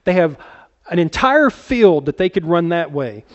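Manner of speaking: ordinary talk, nothing marked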